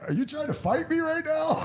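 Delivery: high, amused disbelief; incredulous. Retold or imitated. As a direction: ask it laughing in disbelief. Mocking disbelief at drunk guy.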